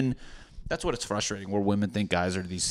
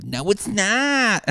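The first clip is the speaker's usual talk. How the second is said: high, slow, louder mock whine; whiny protest; 11 semitones higher, 5 dB louder, much slower than usual. To his therapist, a high drawn-out childish no. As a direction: stretch out a childish denial.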